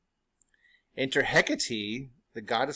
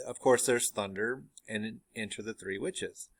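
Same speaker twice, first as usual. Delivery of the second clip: ordinary delivery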